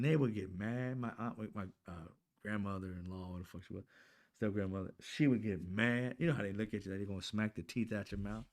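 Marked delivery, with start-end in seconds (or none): none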